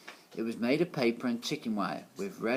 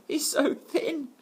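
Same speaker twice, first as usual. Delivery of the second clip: put-on much higher, slow jeering child voice; mocking children; 5 dB louder, slower than usual. A narrator's reading. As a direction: taunt him like a schoolkid. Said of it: "Others cried".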